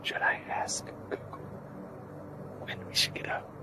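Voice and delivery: soft whisper. Real, not acted. Lying on the ground asking Google, whispering. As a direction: keep it a hushed whisper.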